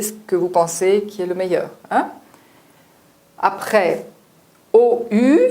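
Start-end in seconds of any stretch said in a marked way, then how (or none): 4.7-5.5 "O U" said high and loud as a clear "ou" sound; teacher's loud, high "ou" demo. Shown as a demonstration. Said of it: "c'est le son du U brésilien".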